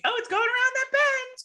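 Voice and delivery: very high mock-boy chatter; boys calling out. Boys planning to catch the basket, high-pitched calling out. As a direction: call out the plan in a high voice.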